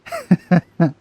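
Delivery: a short, hearty burst of laughter; loud laugh. Real, not acted. Streamer cracking up at a favourite character, loud laugh.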